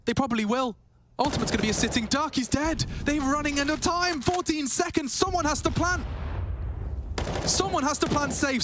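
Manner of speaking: rising excited play-by-play; esports caster. Real, not acted. Clutch round, time running out.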